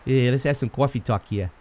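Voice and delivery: hammed-up New York accent, chatty; mock New York accent. Shown as a demonstration. Host riffs on coffee talk in a New York accent. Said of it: "said it with that accent".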